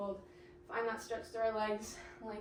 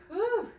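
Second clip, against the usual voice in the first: high, loud whew on a rising breath; real whew mid core set; winded whew; 10 semitones higher, 5 dB louder than usual. Real, not acted. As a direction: puff it out after the crunch. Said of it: "This one's hard to talk to or talk through".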